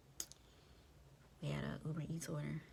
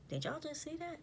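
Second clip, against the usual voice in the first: mock alarm; 11 semitones higher than usual. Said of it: "looking at my coworkers like".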